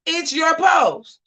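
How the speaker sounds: slow, loud shout up high. Retold or imitated. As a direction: yell it slowly at her. Scolding Danae in retold quote.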